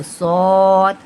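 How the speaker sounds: "सौद" drawn out with a rounded "o"; long, full "सौद" coloured with "o"; heavy "o" sound. Shown as a demonstration. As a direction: fill the mouth on "सौद". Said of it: "ओ की sound आते हुए हम अदा करेंगे".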